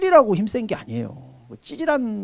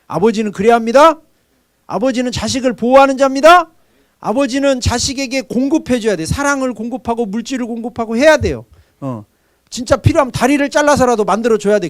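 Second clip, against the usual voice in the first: louder, higher preaching about fathers; preaching at full force; 5 semitones higher, 6 dB louder than usual. Acted. Pastor preaching to the congregation on fathers, loud and forceful. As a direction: hammer home what a father must be.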